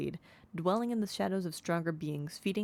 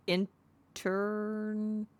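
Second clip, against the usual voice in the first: drawn out slowly, puzzled; hesitant first attempt; 1 slow unsure sounding out; 3 semitones higher, much slower than usual. Real, not acted. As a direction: sound out the strange word slowly. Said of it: "I've never heard that word before".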